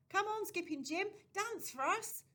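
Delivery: loud, high coaxing call; teasing shout. A narrator's reading. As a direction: boys egging him on to dance. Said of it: "they shouted".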